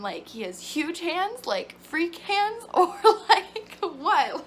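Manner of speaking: mock-baffled question breaking into giggles; laughing disbelief. Retold confused reply, laughing.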